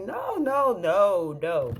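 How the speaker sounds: retold horrified refusal; acting out an emphatic denial; alarmed denial. Denies she ordered the fire.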